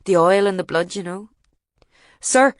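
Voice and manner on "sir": sharp call. Retold or imitated. Soldier stopping him.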